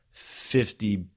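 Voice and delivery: ordinary delivery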